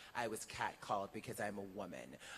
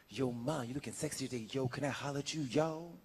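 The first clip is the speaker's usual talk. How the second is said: louder, brash catcall, playfully mocked; imitating a street catcaller; 4 semitones higher, 7 dB louder than usual. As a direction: call out to a woman passing by like a cocky guy on the street. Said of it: "the Latin guy says".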